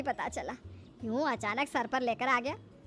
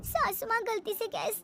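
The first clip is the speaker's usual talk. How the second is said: high-pitched, hurt explanation; plaintive explaining; 10 semitones higher than usual. Acted. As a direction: plead your case to the mother-in-law. Burned bride explains to mother-in-law.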